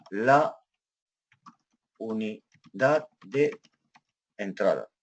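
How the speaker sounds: slow, word by word while typing; spaced-out typing pace; slow dictation. Real, not acted. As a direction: say it piece by piece, as if typing along.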